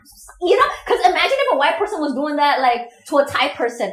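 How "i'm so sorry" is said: embarrassed apology. Retold or imitated.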